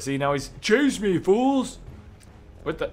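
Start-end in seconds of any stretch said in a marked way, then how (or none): 0.6-1.8 high, hammed-up taunting call; playful taunt. Character luring guards into a chase, hammed-up taunt. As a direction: goad your pursuers in a raised voice.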